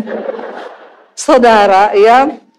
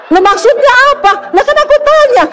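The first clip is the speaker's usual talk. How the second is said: high-pitched defensive retort; indignant protest; 10 semitones higher than usual. Retold or imitated. Scolded wife defending herself to husband, high indignant retort.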